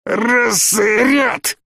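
high, strangled command; barely squeezed out. A narrator's reading. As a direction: choke out an order with your last air. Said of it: "еле выдавил майор".

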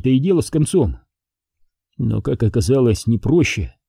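plain reading voice

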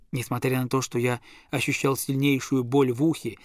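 plain reading voice

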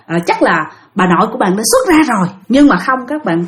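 usual speaking voice

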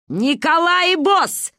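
high, slow, loud outburst; shouted curse. A narrator's reading. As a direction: hurl it out like a curse. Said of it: "выкрикнула Ба". (громкий выкрик)

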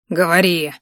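a sharp angry bark. A narrator's reading. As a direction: bark the order down at her. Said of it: "рявкнул дракон".

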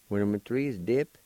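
ordinary delivery

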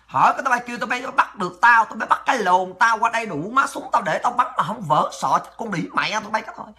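usual speaking voice

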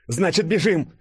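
high, urgent character shout. A narrator's reading. Denis shouting to his friend to run, high urgent yell. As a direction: cry out to get everyone running. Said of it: "закричал Денис".

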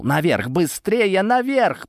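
shouting up the ladder, stretched and high; крик. Acted. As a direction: call them up urgently. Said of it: "прокричал я". (громко и протяжно кричит)

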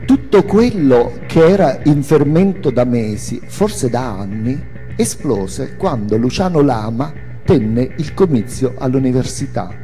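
ordinary delivery

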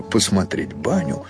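ordinary delivery, nothing marked

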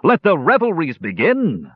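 high-pitched festive cry; reveler's proclamation. A narrator's reading. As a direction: announce the party with a flourish. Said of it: "cried Sturm, executing an impromptu dance".

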